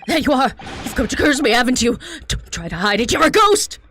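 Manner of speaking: panicked yell, climbing high; frightened shout. Acted. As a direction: scream it at the ghost in terror. Prisoner accuses a ghost.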